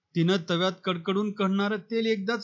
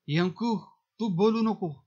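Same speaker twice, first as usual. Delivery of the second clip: shouting. A narrator's reading. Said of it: "पंच ओरडला".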